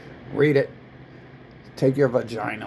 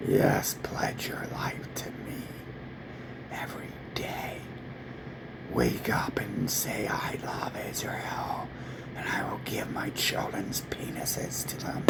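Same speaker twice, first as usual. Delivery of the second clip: mock whisper; 3 semitones lower, 8 dB quieter than usual. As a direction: whisper it like a secret temptation. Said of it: "They're like".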